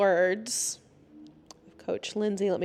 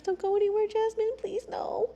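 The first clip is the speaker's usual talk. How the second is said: pleading; 12 semitones higher than usual. Real, not acted. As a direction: plead gently in a high voice. Breaks off to plead with Jasmine.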